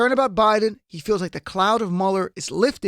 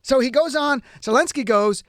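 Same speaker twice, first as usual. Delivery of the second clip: ordinary talk, nothing marked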